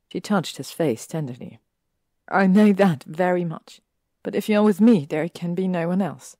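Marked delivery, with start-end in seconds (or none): 2.3-6.4 insistent. Acted. Hermione laying down conditions.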